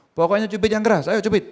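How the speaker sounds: high, loud urging order; a teacher's loud, high-pitched insistence; insistent command. Retold or imitated. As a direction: push them to do it now. Teacher insisting in retold scene.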